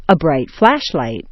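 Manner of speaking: "flash" peaks, "light" drops; compound stress kept. Shown as a demonstration. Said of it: "the stressed point stays the same".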